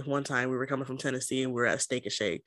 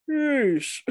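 higher, louder exasperated yeesh; 12 semitones higher, 7 dB louder than usual. Real, not acted. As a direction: scoff at it, exasperated. Exasperated reaction.

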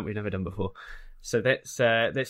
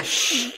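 sharp "shh" for silence; librarian's shush; 11 semitones higher than usual. Acted. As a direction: shush the noisy visitors. Librarian hushes noisy visitors.

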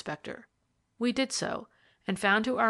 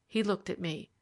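usual speaking voice

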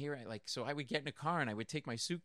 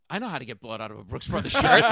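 knowing lawyer; 3 semitones lower, 12 dB louder than usual. Retold or imitated. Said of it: "He goes".

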